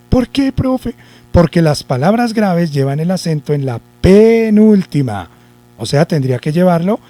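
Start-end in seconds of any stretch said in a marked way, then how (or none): 0.1-0.9 much higher pupil voice asking; imagined pupil. Acted. Pupil asks teacher why not, imagined pupil voice. 4.0-5.3 "penúltima" stretched and loud on PE; stressed key term. Shown as a demonstration. Teacher explains where the accent falls, long emphasis on PE in "penúltima".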